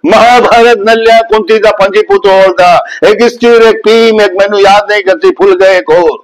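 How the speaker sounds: Nana Patekar in Punjabi. Shown as a demonstration. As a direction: tell it in Punjabi, Nana's way. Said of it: "Punjabi में बोलेंगे तो कैसे बोलेंगे". (पंजाबी लहजे में नाना पाटेकर की नकल, ऊँची आवाज़ में)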